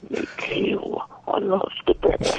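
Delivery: high, airy mock British accent; British accent in a high pretend voice. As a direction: put on a British voice, pitched high. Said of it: "Were you speaking in British accent?".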